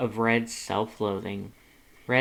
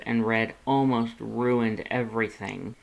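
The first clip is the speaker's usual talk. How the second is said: ordinary delivery, nothing marked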